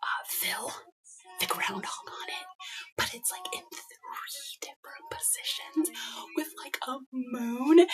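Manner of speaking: secret whisper so husband won't hear. Real, not acted. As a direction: whisper the secret behind his back.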